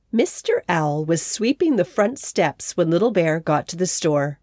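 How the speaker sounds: ordinary delivery, nothing marked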